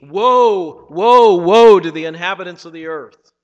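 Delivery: loud, ringing woe cry; proclaimed woe. Acted. Preacher declaring woe on the earth, loud. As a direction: thunder the warning out.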